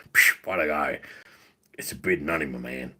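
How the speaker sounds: low put-on catchphrase voice, sinking at the end; Randy. Doing Randy's voice on request, low impression. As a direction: drop into a deep "my man" impression. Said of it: "In Randy's voice".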